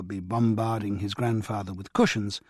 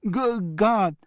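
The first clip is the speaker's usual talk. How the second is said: put-on high, slow squealing boy copying grandad; a little boy gleefully aping the shout; child mimicking shout; 10 semitones higher, much slower than usual. A narrator's reading. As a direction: Julian mimics Charles's shout, high and drawn out. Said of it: "shouted Julian".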